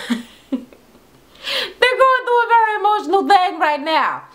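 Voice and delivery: high, slow words broken by laughter; half laughing, half mock-crying; laughing through it. Real, not acted. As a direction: giggle while defending the twins. Mocks the ugly-cry faces.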